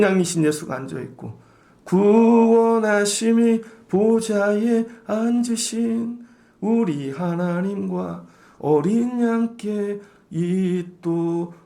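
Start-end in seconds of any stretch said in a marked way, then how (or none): 1.8-11.5 solemn slow recital; slow scripture recitation. Acted. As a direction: read slowly like holy scripture.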